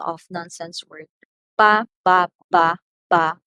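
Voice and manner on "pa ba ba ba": "Pa, ba, ba, ba" in loud separate beats; loudness demo. Shown as a demonstration. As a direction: punch out "Pa, ba" as loud nonsense syllables. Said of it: "Loudness is a component of prominence".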